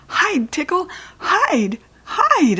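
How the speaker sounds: desperate to hide the dog before Mother comes; panicked urging. Acted.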